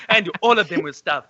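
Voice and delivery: put-on African accent, comic punchline; hammed-up African accent, amused; mock African accent. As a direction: deliver it in a caricatured African accent. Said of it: "doing all of his racist accents".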